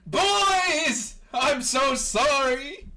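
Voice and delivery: high, loud, drawn-out wail of apology; over-the-top emotional outburst; booming apology. Acted. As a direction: burst in hollering, stretching every word. Armstrong bursts in to hug them.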